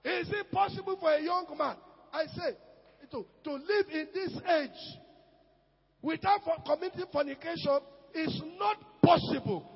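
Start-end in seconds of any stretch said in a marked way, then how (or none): none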